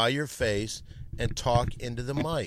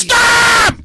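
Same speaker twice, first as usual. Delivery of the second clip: piercing, very high yell; furious shout; 13 dB louder than usual. Real, not acted. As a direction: scream to cut him off. Host shouts over Perry.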